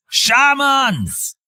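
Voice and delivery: high drawn-out warning cry; shouted alarm. A narrator's reading. Soldier running back to warn the Panthers, shouting. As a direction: call it out high and long, without real volume.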